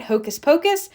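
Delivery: ordinary delivery, nothing marked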